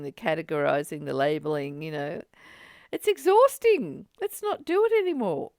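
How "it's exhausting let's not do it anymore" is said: higher and louder, laughing exasperation; playfully exhausted; exasperated laugh. Real, not acted. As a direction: throw up your hands, laughing. Laughing weary plea.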